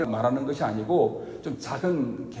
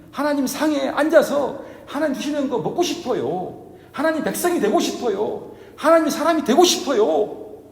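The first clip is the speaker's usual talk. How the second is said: a woman begging Jesus, rising to fervent entreaty; earnest pleading; 7 semitones higher than usual. Pretend. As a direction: beg with growing urgency. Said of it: "그 마음이 정말 간절했던 것입니다".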